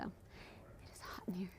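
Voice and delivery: slow whispered aside, barely there. Real, not acted. Speaker alone at the mic, whispering an aside. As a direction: breathe it out to yourself, slowly. Aside muttered while waiting for questions.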